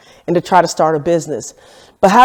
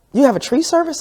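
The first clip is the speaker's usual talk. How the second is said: high-pitched incredulous question; mock surprise; 8 semitones higher, 4 dB quieter than usual. Shop man questions her about a tree service, imitated surprise. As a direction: act out his disbelief, pitched well up.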